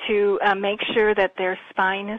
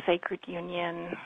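normal talking voice

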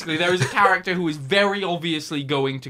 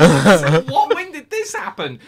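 laughing disbelief; 3 semitones higher, 9 dB louder than usual. Real, not acted.

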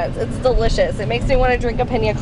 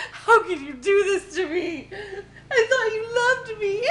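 mock hurt puppy voice, high with laughter; voicing the puppy; 7 semitones higher, 3 dB quieter than usual. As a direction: speak for the sulky dog, laughing.

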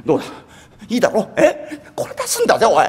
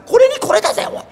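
a buyer pressing the seller to take his price; pushy higher demand; 3 dB louder than usual. Acted. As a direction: voice jumps way up, insisting. Haggling, pushing harder.

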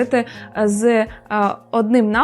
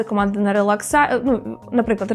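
normal talking voice

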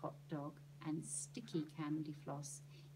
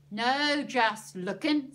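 loud, slow, harsh snap; snapped; 4 semitones higher, 12 dB louder, much slower than usual. Acted. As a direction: cut him off hard and loud. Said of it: "snapped the man".

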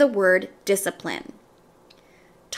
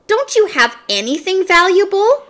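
exasperated; 9 semitones higher, 5 dB louder than usual. A narrator's reading. Exasperated robber.

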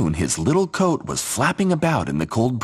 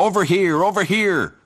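higher calling crows; 10 semitones higher than usual. Acted. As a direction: call them over.

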